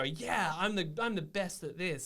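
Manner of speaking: mock-confident bragging; gutsy self-promoter. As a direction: put on a mildly brash LinkedIn brag. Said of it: "take a bit of the guts that LinkedIn people have".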